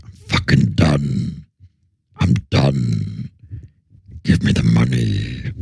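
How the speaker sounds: low, slow, dragged-out mock star voice; imitating a fed-up actor. Imagined actor refusing another take, low fed-up drone. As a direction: sink low and drag it out, done with it all. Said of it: "He's like".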